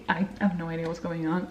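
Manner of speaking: normal talking voice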